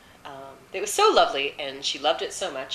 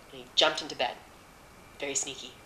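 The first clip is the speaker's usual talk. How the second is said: ordinary delivery, nothing marked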